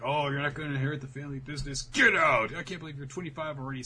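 mock nagging parent. Voicing nagging family in flashback.

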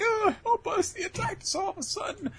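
very high, loud squeal; mock frightened wail. Two attackers crying to Murakami for help, mock frightened squeal. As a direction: squeal like a hammed-up helpless victim.

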